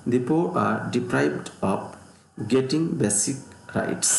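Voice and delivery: very slow, careful dictation; very slow example reading. Shown as a demonstration. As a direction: stretch every word for note-taking. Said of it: "teacher reads example sentence".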